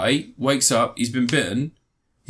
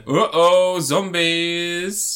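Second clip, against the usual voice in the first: mock alarm; 7 semitones higher, 3 dB louder, much slower than usual. Wife finds zombies below deck.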